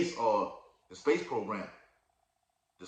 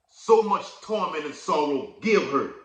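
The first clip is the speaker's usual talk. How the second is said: loud, raised scripture reading; preaching scripture; 4 semitones higher, 6 dB louder, slower than usual. Acted. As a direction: proclaim the verse to the congregation. Reads scripture aloud, preaching.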